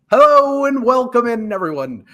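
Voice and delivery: high, loud show-opening welcome; host welcome. Real, not acted. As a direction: greet the audience like a host. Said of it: "show opening".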